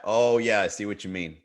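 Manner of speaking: normal talking voice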